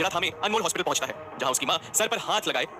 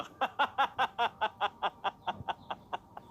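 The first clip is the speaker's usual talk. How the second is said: high villain cackle, softer and dying off; fading mad laugh; 6 semitones higher, 6 dB quieter than usual. Acted. Abu Lulu's mad laugh trails away. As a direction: let the cackle fade out. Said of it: "पागलों की तरह हंसते हुए कहता है".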